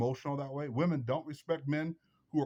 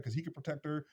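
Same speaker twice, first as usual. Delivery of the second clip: normal talking voice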